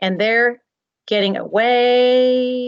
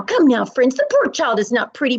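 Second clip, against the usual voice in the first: usual speaking voice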